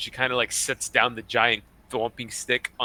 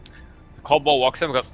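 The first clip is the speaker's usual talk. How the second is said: ordinary delivery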